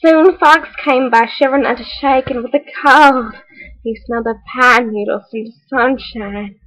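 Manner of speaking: plain reading voice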